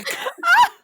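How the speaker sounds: very high, loud cackle; burst of laughter. Real, not acted. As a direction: explode into a piercing laugh. Laughs at own rivalry joke.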